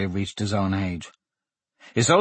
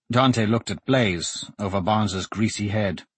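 ordinary delivery

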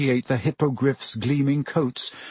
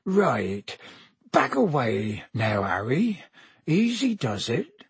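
Hagrid; 3 dB quieter, slower than usual. A narrator's reading. Hagrid tells his pupil to retreat, worried. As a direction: warn gently without startling the beast. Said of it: "sounding worried".

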